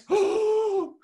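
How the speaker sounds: long, loud, high intake of breath; big demonstrated gasp. Shown as a demonstration. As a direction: show an exaggerated deep breath. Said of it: "Take a deep breath like this".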